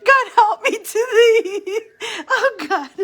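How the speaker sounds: high, loud words choked with laughter and tears; can't get through it for laughing. Real, not acted. Picturing the little alien in her bed, high tearful laughter. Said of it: "I'm such a disaster already".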